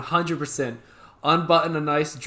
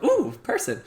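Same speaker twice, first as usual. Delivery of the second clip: high, intrigued little retold reaction; mock excitement; 8 semitones higher than usual. Creator acting out a new DM popping up, mock excitement. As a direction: perk up high at a rare arrival. Acts out seeing a rare message.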